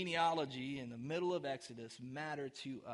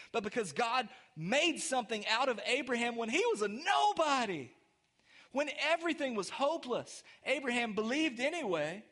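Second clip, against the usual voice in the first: raised-pitch sermon voice, punching key words; impassioned preaching; 5 semitones higher, 5 dB louder than usual. Real, not acted. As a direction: preach it hard to the congregation.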